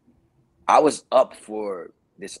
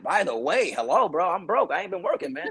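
incredulous comeback climbing ever higher in pitch; mock indignation; 10 semitones higher than usual. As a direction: protest in disbelief, voice rising as you go. Retold indignant reply to agent.

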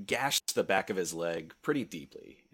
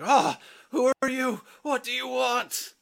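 high, loud, panicked cry; terrified screaming; 9 dB louder, slower than usual. Acted. Game master as the wounded man shocked by his attacker, screaming in terror. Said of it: "he's screaming".

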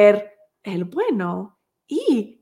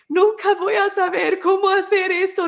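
much higher, anguished "Nunca voy a saber cómo hacer esto"; despairing cry; 10 semitones higher, 3 dB louder than usual. Retold or imitated. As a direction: let it break out high and desperate. New mother's despairing thoughts. (grito angustiado, mucho más agudo)

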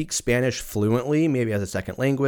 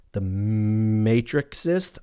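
usual speaking voice

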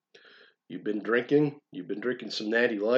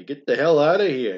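mock yell; 4 semitones higher, 6 dB louder than usual.

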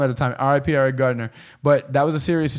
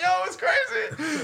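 much higher, softer words squeezed through laughter; laughing; 6 dB quieter than usual. Real, not acted. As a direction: laugh it out, almost crying. Recalling snapchat, laughing hard.

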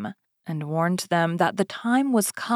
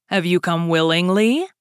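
louder, imposing Beast voice; Beast's question; 6 dB louder than usual. Acted. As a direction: ask it like a looming beast.

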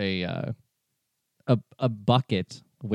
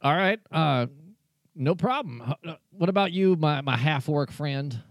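higher-pitched Southern barkeep; friendly Southern bartender, pitched up; Southern bartender accent; 5 semitones higher than usual. Acted. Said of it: "I don't know what this accent is".

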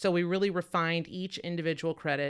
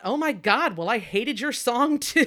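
much higher and louder, slower, laughing; indignant retort; 7 semitones higher, 9 dB louder than usual. Retold or imitated. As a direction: fire back with delighted spite. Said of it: "And I thought".

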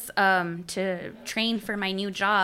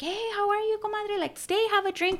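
friendly greeting; 10 semitones higher, 6 dB quieter than usual. Retold or imitated. Voicing friend greeting at bar.

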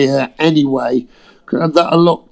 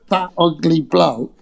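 usual speaking voice

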